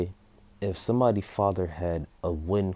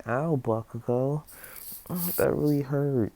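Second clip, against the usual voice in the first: higher-pitched, mildly pained whine; hurt child; 8 semitones higher than usual. Acted. Izuku to Bakugo after being burned, mock hurt child. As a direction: act a small boy saying ow, stretched out.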